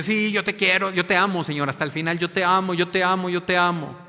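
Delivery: high, loud, fervent declaring; eager vow. Acted. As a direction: shout your love like an eager disciple. Peter's eager earlier vow.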